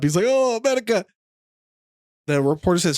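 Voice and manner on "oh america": high-pitched mock Kazakhstani exclamation; flustered cry in a put-on Kazakhstani accent; Borat's accent. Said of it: "he gets embarrassed. He stands up".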